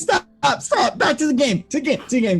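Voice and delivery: pleading. Real, not acted. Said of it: "begging to stop".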